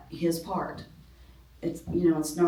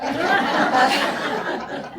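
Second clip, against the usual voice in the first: loud, high burst of real laughter; big laugh; 9 semitones higher, 6 dB louder than usual. Real, not acted. Preacher cracking up at her own parenting story, laughing. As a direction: let a full laugh out.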